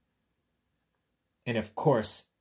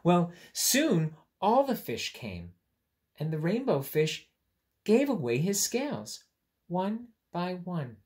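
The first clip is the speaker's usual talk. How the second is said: higher, louder storytelling voice; storybook narration; 7 semitones higher, 6 dB louder than usual. A narrator's reading. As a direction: tell the happy ending brightly.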